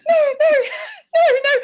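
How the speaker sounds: high, loud mock-horrified refusal; retold panicked screaming. Retelling her horror at eighteen in the clinic, mock screaming. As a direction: re-enact panic with high cries and laughter. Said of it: "could probably hear my screams".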